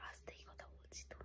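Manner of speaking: defensive hushed whisper. Real, not acted. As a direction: whisper back it's quiet.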